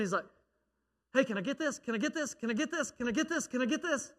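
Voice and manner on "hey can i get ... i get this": put-on kid begging. Nephew begging at flea market.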